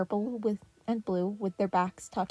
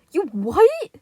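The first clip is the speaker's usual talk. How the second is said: high, loud outraged yell; teen brother yelling at his dad; 8 semitones higher, 12 dB louder than usual. A narrator's reading. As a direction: shout it in disbelief. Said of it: "Leo yelled".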